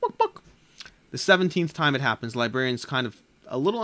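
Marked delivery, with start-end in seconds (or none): 0.0-0.4 high hen cluck, laughing through it; chicken clucking with a laugh. Acted. Chicken handing the librarian the book, laughing cluck. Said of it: "while going".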